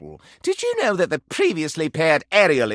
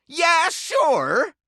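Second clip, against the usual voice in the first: sarcastic shout; 10 semitones higher than usual. Acted. Repeated louder with a shout.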